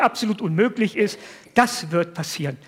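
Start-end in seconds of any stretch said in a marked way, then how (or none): none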